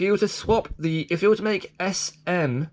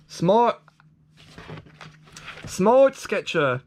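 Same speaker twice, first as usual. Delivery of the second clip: slow drawn-out name; 6 semitones higher, much slower than usual. Pretend. Drawn-out toy name read.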